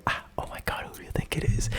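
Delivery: secretive whisper under the breath; whispered to herself. Retold or imitated. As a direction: whisper it to yourself in suspense. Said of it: "talking to myself".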